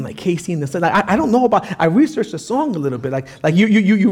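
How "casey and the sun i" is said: normal talking voice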